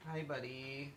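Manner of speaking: soft cooing at a pet; soft pet talk. Real, not acted. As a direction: murmur gently to the little buddy. Talking softly to pet.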